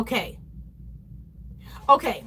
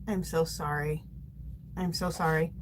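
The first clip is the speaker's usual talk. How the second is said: soft, low consoling voice; comforting a viewer in her chemo chair; tender sympathy; 5 semitones lower, 9 dB quieter than usual. Real, not acted. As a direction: gently comfort someone in chemo.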